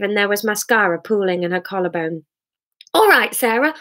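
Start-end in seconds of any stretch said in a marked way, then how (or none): 2.9-3.7 higher, raised exasperation; exasperated man. Acted. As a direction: push back, fed up. Hamish exasperated.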